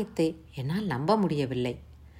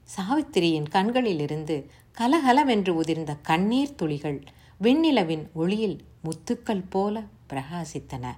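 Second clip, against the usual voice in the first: ordinary delivery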